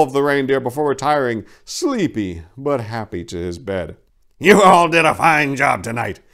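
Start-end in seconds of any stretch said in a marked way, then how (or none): 4.4-6.2 louder, hearty praising Santa; jolly Santa. A narrator's reading. Santa praising the reindeer after the night, lightly voiced hearty cheer. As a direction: beam with pride at the team. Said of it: "the jolly old elf chortled".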